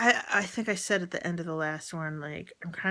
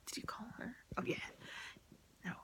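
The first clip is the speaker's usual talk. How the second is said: whisper; 4 semitones higher, 16 dB quieter, much faster than usual. Real, not acted. Asking herself in an aside.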